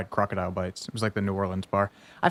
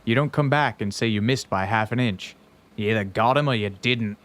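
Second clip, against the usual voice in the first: put-on Australian accent. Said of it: "can you do an Australian accent".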